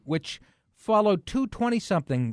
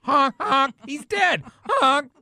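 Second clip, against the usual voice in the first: high, loud goofy clown voice; silly clown; 10 semitones higher, 4 dB louder, slower than usual. Pretend. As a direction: honk it out like Bozo the Clown. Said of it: "here's Bozo the Clown".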